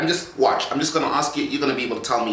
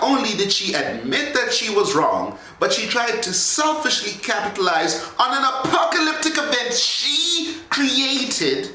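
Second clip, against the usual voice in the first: ranting shout; 9 semitones higher than usual. Acted. Heated rant about Varana.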